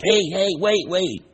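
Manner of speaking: put-on high little-girl squeal of protest. Granddaughter protests grandpa stealing her bean, high squeal. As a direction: squeak it out like a toddler losing her food. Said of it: "first reaction was like".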